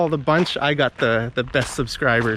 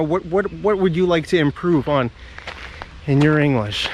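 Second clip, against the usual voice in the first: usual speaking voice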